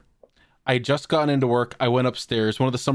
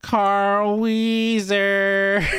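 very slow, higher stretched-out name; drawn-out name call; 6 semitones higher, much slower than usual. Retold or imitated. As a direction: slowly stretch the name out like a prank. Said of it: "And then he just goes".

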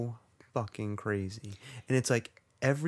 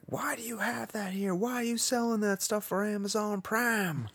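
very high, prissy mock shopper; mock complaining customer; 11 semitones higher than usual. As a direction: squeak out a snooty complaint.

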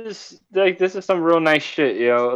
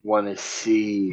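low, slow, quiet menace; slow creepy echo; 6 semitones lower, 5 dB quieter, much slower than usual. Retold or imitated. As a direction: echo it slowly and darkly. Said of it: "repeating the creepy line".

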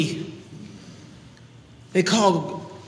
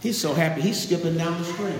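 ordinary talk, nothing marked

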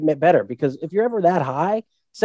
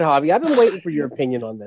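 usual speaking voice

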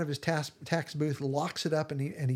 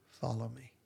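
softly, low and gentle; quiet invitation; 4 semitones lower, 8 dB quieter than usual. Retold or imitated. Jesus inviting Matthew to follow, soft low invitation. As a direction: draw him in quietly.

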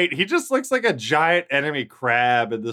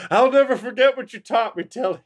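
laughing mock thanks; 7 semitones higher, faster than usual. Voicing grateful recruit.

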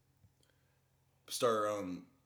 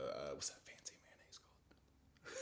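mock sob whisper; 10 semitones lower, 20 dB quieter, slower than usual. Crying joke about mayo tears.

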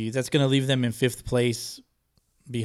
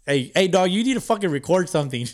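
calling out; 6 semitones higher than usual. Real, not acted. Calling out a friend to record.

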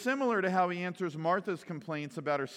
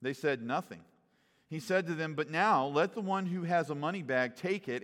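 measured, lower scripture reading voice; 3 semitones lower than usual. Acted. Pastor reading Luke aloud to the congregation, measured scripture reading. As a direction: read the passage steadily, like a lesson from the pulpit.

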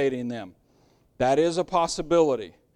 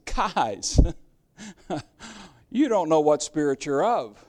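gentle amused rebuke; 3 semitones lower than usual. Retold or imitated. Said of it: "Jesus looks at 'em and laughs says".

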